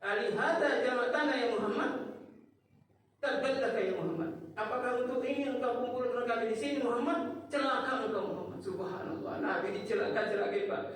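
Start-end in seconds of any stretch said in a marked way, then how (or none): 0.0-8.4 mock angry rebuke, pitched higher; scolding the Prophet. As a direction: snap at him like an enraged uncle. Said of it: "dia bentak-bentak Nabi".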